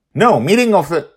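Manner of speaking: sharp loud correction, high; angry snap. Acted. Father correcting the name, loud angry snap. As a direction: snap the correction before catching yourself. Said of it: "he automatically snaps angrily".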